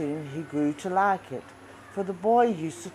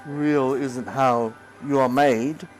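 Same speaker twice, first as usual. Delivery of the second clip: put-on lower, wise old toy horse; Skin Horse; 4 semitones lower, 3 dB louder than usual. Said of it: "said the Skin Horse".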